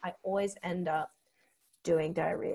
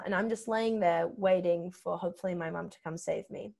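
ordinary talk, nothing marked

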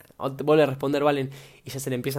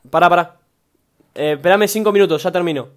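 loud, raised-pitch call across the house; shouted request to wait; 3 semitones higher, 10 dB louder than usual. Real, not acted. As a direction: break off and call to grandmother for patience. Calls to grandmother to wait.

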